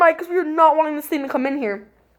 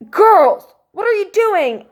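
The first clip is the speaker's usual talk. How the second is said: high, raised-voice imitation of mom; exasperated mom; 8 semitones higher, slower than usual. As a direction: call out like a startled, scolding mother. Voicing mom at the door.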